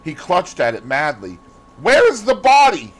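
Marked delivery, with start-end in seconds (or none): 1.8-2.9 high, loud, frantic demand; urgent exclamation. A narrator's reading. Dorian demands the body from the gamekeeper, exclaiming. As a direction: exclaim it, voice shooting up. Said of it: "he exclaimed".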